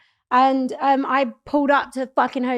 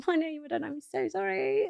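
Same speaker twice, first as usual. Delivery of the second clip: high, soft, pleading mock sob; retold crying, slowing into the apology; imitated tearful apology; 7 semitones higher, 7 dB quieter than usual. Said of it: "obviously I was crying 'cause I was really upset".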